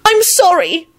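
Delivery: high-pitched, distressed apology; upset apology. Acted. Elizabeth apologising to Lupin, upset and high-pitched. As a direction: blurt out an apology, voice pitched right up. Tearful apology in acted scene.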